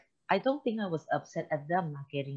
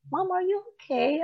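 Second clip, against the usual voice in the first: put-on much higher, childlike voice; innocent kids. Boys look up at mom leaving the bathroom, innocent childlike concern. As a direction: ask it like an innocent little boy. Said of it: "innocent face looking at me".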